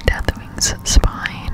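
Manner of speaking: normal talking voice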